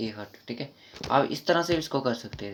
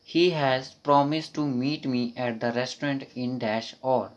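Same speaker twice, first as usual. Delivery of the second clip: slow dictation; 3 dB louder, much slower than usual. Shown as a demonstration. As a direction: read it very slowly, word by word. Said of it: "reading the question slowly".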